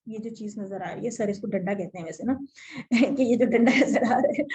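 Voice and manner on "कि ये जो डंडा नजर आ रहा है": real laughter bursting through the words; giggling teacher, louder than usual; laughing. Real, not acted. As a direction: laugh out loud while pointing at the stick. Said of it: "मुझे बड़ी हंसी आती है".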